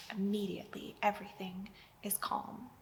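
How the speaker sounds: soft whisper; whispered stillness; hushed. A narrator's reading. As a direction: let the calm settle in a whisper. Storm suddenly stills.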